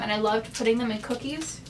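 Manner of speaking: ordinary delivery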